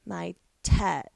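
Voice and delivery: put-on odd Valley Girl whine, lightly done; a hint of a ditzy Valley Girl. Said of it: "in this really odd Valley Girl voice".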